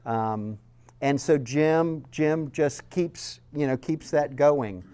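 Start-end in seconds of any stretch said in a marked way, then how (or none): none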